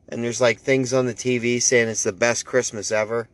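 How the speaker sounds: normal talking voice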